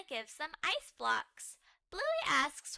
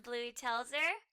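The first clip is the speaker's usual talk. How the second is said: ordinary delivery, nothing marked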